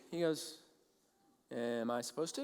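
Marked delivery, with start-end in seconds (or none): 1.5-2.4 low, soft, unbothered mumble; indifferent reply. Retold or imitated. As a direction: play him as blankly unconcerned. Bully doesn't remember him, indifferent.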